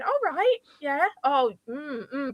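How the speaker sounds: nod along vaguely as if you follow; airy, noncommittal "oh right"; feigned understanding. Retold or imitated.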